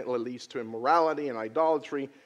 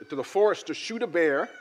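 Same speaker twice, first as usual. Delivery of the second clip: normal talking voice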